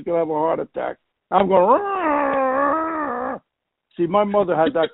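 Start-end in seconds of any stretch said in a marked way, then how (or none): 1.6-3.4 much higher blaring honks like wounded cows; trombone blare imitation. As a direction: blast it out like a kid's first trombone. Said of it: "I was making this noise like".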